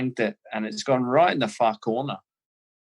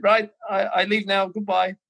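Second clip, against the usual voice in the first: higher-pitched mock Scouse-German farewell; Scouse German accent; 7 semitones higher than usual. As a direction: imitate his abrupt exit in a Scouse-German accent. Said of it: "this weird Scouse German accent".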